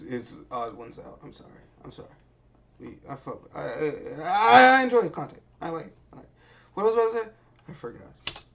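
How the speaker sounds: ordinary delivery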